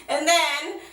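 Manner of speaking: ordinary talk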